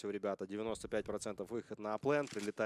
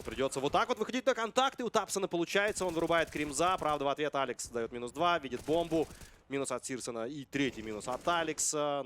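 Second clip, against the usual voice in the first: rising excitement, higher and louder; excited play-by-play; 5 semitones higher, 5 dB louder than usual. Real, not acted. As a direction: call the fight as it happens. Caster reacting to live fight.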